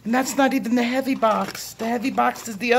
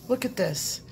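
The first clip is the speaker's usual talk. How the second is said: ordinary delivery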